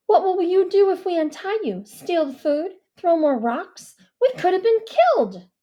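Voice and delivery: put-on loud, high, angry girl's voice; furious girl's tirade; angry shouting. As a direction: yell at the boy who threw rocks. Mia yelling accusations.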